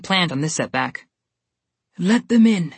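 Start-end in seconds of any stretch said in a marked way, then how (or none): 2.0-2.7 frail voice. Acted. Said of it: "said a frail voice".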